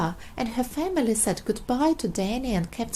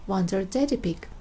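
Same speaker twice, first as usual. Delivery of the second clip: ordinary delivery, nothing marked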